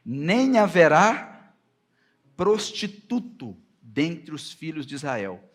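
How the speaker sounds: slow, emphatic verse reading, punched openings; scripture reading. Acted. Preacher reads God's order about Anat to the congregation, slow and emphatic. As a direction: proclaim the verse slowly and loudly, pausing between phrases.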